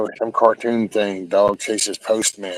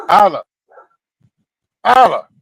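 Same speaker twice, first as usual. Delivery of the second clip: yelling dog's name; 10 semitones higher, 7 dB louder than usual. Real, not acted. Calling the dog sharply.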